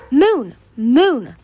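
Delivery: high, loud cry of her name; urgent calling. A narrator's reading. Darkstalker calls to Moon in her vision, urgent calling. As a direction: call out to reach her urgently.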